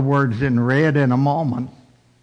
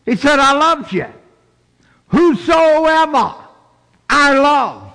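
high, emphatic preacher's proclamation; proclaiming; 9 semitones higher, 4 dB louder than usual. Acted. Preacher declares Jesus' love for whosoever, high proclaiming. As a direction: proclaim it high, drawing out the key word.

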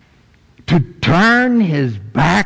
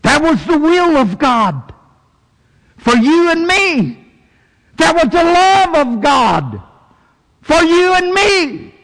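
building preaching; 12 semitones higher than usual. Real, not acted. Preaching climax.

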